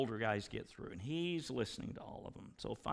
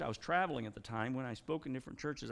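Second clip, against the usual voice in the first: normal talking voice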